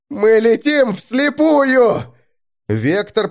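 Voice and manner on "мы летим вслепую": very high, drawn-out loud cry; panicked scream. A narrator's reading. Driver racing blind through fog, panicked scream. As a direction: wail it out loud, slowly. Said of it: "закричал Грач".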